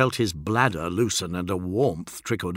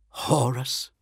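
a touch of hoarseness, higher; hoarse question; 4 semitones higher than usual. A narrator's reading. Fulgrim hears his brother named, hoarse. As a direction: ask it in a mildly hoarse voice. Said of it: "his voice hoarse".

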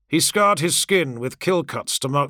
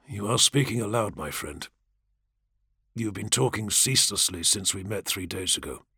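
softer, lower and understanding; patient; 5 semitones lower, 5 dB quieter than usual. A narrator's reading. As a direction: gently explain to a confused friend. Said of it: "voice stayed patient, stayed understanding".

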